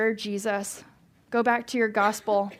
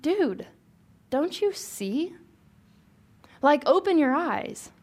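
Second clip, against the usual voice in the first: Jesus exasperated; 5 semitones higher, slower than usual. Retold or imitated. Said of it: "and Jesus is like".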